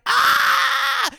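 a high, loud startled scream; imitated scream. Retelling how his sister screamed when she saw him lying still. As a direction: mimic the sister's shriek of fright. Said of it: "and she screams".

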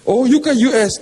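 high, loud impressed gasp; impressed church lady. Acted. Preacher mimics a lady loudly dazzled by her friend's trip to the US. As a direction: act dazzled by the US.

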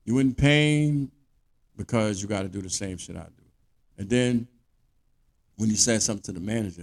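ordinary talk

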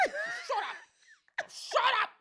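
high, loud, laughing mock yell; acted frantic shushing shout. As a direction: yell it over your own laughter.

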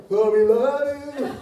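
high, drawn-out phony greeting; hammed-up churchgoer faking affection; fake sweetness. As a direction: gush slowly in a put-on high voice. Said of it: "I'm doing less faking now".

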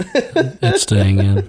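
teasing. Real, not acted. Teasing refusal, slow.

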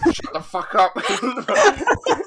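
high, loud, drawn-out outburst; laughing shout. Real, not acted. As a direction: shout them down while laughing. Teased, tells friends to shut up laughing.

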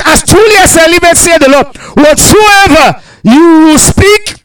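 high, loud shouted scripture, slowed; preaching at full force. Acted. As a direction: thunder the oath out slowly. Preaching God's oath at full force.

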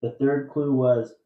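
loud, measured trivia question read; quiz host reading. Acted. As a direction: read the clue out to the room. Host recaps the clues.

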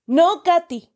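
a high cry of alarm to stop her; sharp high shout. A narrator's reading. Uncle shouting at Katy not to throw up, high and sharp. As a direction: yell her name to stop her. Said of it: "gritó mi tío".

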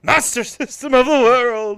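slow booming hype announcer; announcer shout. Pretend. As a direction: bellow it like a wrestling intro. Mock announcer shout of name.

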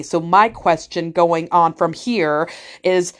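very slow, deliberate setup of a question; mock-patient slow delivery; slow talk back. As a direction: spell it out slowly, word by word. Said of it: "you slow talk back to him".

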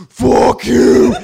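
very loud, high yell; background scream. Retold or imitated. Companion screaming at clerk from the room, loud high shout. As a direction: imitate her hollering from inside. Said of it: "in the background".

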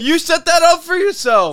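mock wail. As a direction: wail it out like a fake sob. Friend mock-yells at him.

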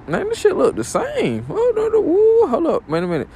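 very high-pitched, incredulous outburst; acted disbelief. Worker seeing the second paycheck unchanged, acted high-pitched disbelief. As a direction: pitch leaps up into alarmed protest. Acts out reaction to second check.

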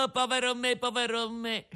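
high anguished wail of despair; crying out. A narrator's reading. Pinocchio learns he'll become a donkey, wailing high. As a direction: wail your misfortune. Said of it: "gridò Pinocchio".

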